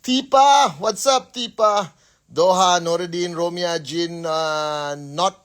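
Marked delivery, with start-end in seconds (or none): 0.0-1.9 excited call-out. Real, not acted. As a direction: call the name out like spotting a friend. Hype shout-out to a viewer.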